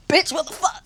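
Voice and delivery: a touch of shocked anger, imitating herself; acting out her own startled reaction; mock outraged snap. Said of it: "The way I turned around was like".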